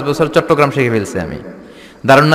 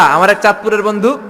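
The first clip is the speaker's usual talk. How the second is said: usual speaking voice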